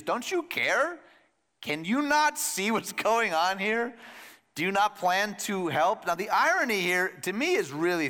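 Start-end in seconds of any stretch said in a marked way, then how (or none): 0.0-6.1 higher, slow, pleading complaint; exasperated plea. Retold or imitated. Martha demands Jesus care, exasperated and slow. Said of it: "she says what the disciples say".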